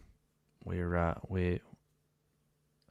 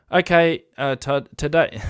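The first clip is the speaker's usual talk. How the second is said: put-on New Zealand accent; 6 semitones higher, 3 dB louder than usual. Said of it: "I'll try and do the accent".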